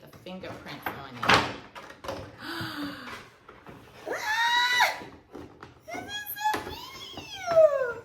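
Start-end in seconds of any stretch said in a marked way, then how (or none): 1.5-5.8 high delighted gasp. Real, not acted. Thrilled at the cute box. 5.9-8.0 high slow squeal; delighted squeal. Real, not acted. Thrilled at the cute box.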